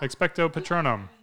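Expecto Patronum rattled off fast, clipped together; too quick spell. Shown as a demonstration. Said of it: "Too quick".

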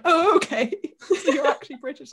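laughing, high incredulous question; surprised. Retold or imitated. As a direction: ask it in amused disbelief. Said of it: "absolutely like".

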